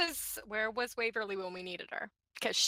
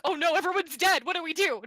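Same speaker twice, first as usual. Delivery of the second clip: louder, higher pretend alarm; mock panic; 6 semitones higher, 7 dB louder than usual. Teasing the party about their failed ambush, mock panic. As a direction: play up a sudden comic panic.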